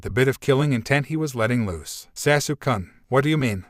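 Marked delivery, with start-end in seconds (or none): none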